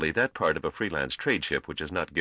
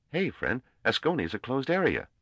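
put-on a touch higher, breezy young trader; lightly voiced youngster Gorm; 11 semitones higher than usual. A narrator's reading. Young trader teasing his elder colleague, lightly voiced and breezy. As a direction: play him easygoing and a bit cocky.

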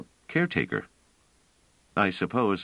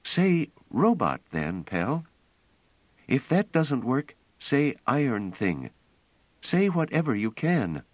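higher-pitched, insistent story voice; earnest, raised-pitch character dialogue; earnest woman character, urgent; 9 semitones higher than usual. A narrator's reading.

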